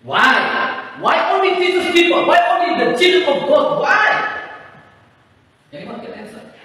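ordinary talk, nothing marked

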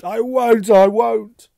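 high, very loud anguished cry; sobbed wail. A narrator's reading. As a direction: wail it out as if sobbing. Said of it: "he sobbed".

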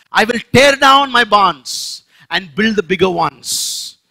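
loud, slow proud declaration; rich fool's boast. Acted. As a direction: announce the plan proudly. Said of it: "How is it translated today?".

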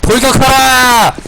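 slow booming battle cry to charge; rally the troops like a general. Pretend.

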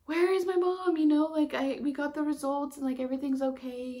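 high, loud and urgent; urgent excited. Retold or imitated. Calling her stepdad urgently, high and loud. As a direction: demand to know where mom is.

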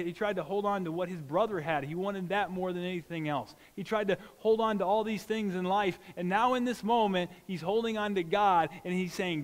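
ordinary talk

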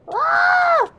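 piercing high shout of alarm; panicked scream. Real, not acted. Falling off the wrong minecart path, shrill scream. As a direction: scream as you plunge.

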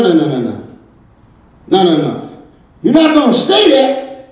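normal talking voice

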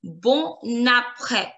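slow BON APRÈS with the N carried over; liaison. Shown as a demonstration. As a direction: stretch it as "bo-naprè", N linking into APRÈS. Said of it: "make a liaison".

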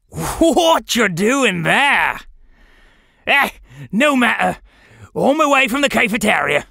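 put-on British accent, calling out to strangers; rough British accent. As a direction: talk like a rough British rogue. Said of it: "A rough British accent".

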